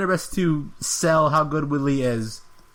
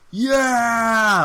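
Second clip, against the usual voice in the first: loud, high victory shout; triumphant yell; 7 semitones higher, 4 dB louder than usual. Retold or imitated. Fighter celebrating to the crowd with a loud yell. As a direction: belt it out like a winner. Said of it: "he's like".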